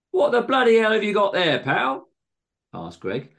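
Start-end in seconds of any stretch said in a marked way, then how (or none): 0.1-2.1 loud, high-pitched outraged challenge; indignant demand. A narrator's reading. Greg demanding what John has got, indignant. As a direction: confront him in high outrage.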